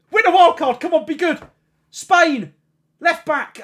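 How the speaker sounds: will the card to be good; tense excitement. Real, not acted. Streamer watching a pack reveal, high tense excitement.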